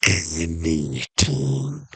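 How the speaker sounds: very slow, low and drawn out; mysterious genie. Acted. Genie confirming the offer to castaway, slow low voice. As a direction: confirm it low, stretching every syllable. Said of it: "And the genie goes".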